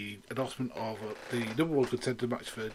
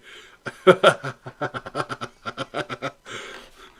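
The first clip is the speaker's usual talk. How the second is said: long wheezing real laughter; breathy real laugh; 7 dB louder than usual. Real, not acted. Keeps cracking up.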